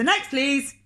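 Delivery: loud, high shouted next please; loud call. Acted. Grocer loudly calling the next customer. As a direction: call the next customer over his head.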